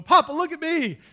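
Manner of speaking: put-on high, loud shout of a small boy showing off; excited child call. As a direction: call out like a proud kid at the pool. Child calling out from pool.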